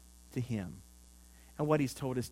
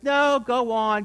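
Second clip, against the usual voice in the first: a slow, higher, louder call of "No, go on"; mock shout back, slow; 7 semitones higher, 8 dB louder, slower than usual. Man on the roof refusing the helicopter rescue, slow mock shout. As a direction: wave the rescuers off, stubborn and loud.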